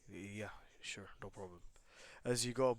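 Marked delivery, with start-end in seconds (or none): 0.1-1.6 whispered, low, offhand answer; low-key reply. Acted. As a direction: murmur it softly and low. Izuku's low-key reply to thanks.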